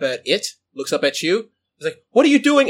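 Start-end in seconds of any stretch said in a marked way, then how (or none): 2.1-2.7 high, loud startled demand; acted frantic protest; panicked outcry. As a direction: cry it out in alarm, pitched up. Terrified character confronts the creature.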